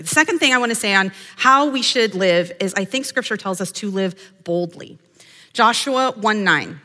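normal talking voice

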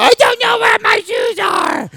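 put-on high, loud childish shout; a kid yelling back, helpless; whiny kid. Kid answers parent about shoes.

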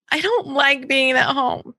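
slow, whining daughter's complaint; whiny child. Retold or imitated. Daughter complaining about schoolwork, slow and whiny. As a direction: drag it out like a sulky kid. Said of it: "actual things that she said to me".

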